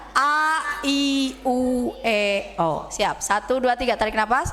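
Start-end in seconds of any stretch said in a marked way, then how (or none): 0.1-2.9 A, I, U, E, O drawn out, pitch sinking to O; vowels while exhaling. Shown as a demonstration. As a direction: let the breath out through each vowel. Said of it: "Nanti sambil dibuang bilang".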